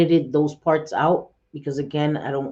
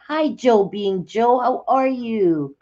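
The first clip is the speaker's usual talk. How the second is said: cheerful greeting; 6 semitones higher, 4 dB louder, slower than usual. Real, not acted. Greeting a chat viewer.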